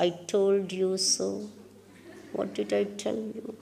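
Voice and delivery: soft, low, knowing inner voice; quiet I-told-you-so. Acted. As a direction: let the reproach sink very quietly.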